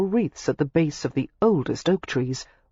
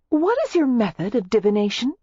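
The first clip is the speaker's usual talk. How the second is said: blithe; 7 semitones higher than usual. A narrator's reading. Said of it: "she asked the woman blithely".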